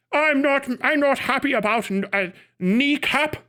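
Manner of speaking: half-hearted mock Northern Irish accent. Streamer mocking Northern Irish critics of a band, failed Northern Irish accent. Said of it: "Can't even do your funk ass accents".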